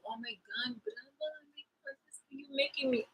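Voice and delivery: soft, high, incredulous; hushed disbelief. Real, not acted. Reacting to the betrayer's excuse, a soft incredulous hush. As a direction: say it under your breath in disbelief.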